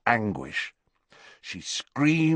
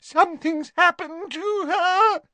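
sobbing. Acted. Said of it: "sobbed Leanne".

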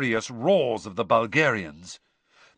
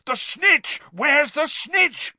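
high, urgent shout; bellow; 13 semitones higher, 4 dB louder, slower than usual. A narrator's reading. Charlie to the row, searching for the snitch, bellowing. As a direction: bellow a question along the row. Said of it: "bellowed Charlie along the row".

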